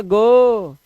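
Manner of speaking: "गो" with a held O; final O sound. Shown as a demonstration. Teacher rounds off O examples, drawn-out "गो". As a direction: stretch the vowel of "गो". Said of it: "तो ओ को ओ पढ़ते हैं".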